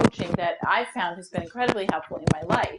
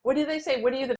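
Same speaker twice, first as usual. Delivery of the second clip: mock frantic client; 5 semitones higher than usual. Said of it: "really at that high energy".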